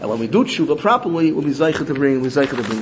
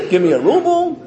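laughing mockery; 10 semitones higher, 3 dB louder than usual. Businessman mocking, laughing.

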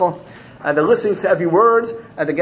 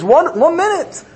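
defender; 12 semitones higher than usual. Retold or imitated. Defender pleading for people who couldn't sit still, high plea. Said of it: "the defender comes and says".

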